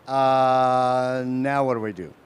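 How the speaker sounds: drawn-out, stalled "uh", then a lost question; mock clueless. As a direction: play a stumped filmmaker, lightly. Filmmakers stuck after starting a film.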